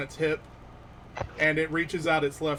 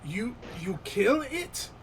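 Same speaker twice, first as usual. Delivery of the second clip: slow, higher, incredulous goblin question; halting disbelief, rising at the end; broken Common; 6 semitones higher, slower than usual. Acted. Wounded goblin asking about the slain monster, halting and incredulous. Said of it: "again in Broken Common".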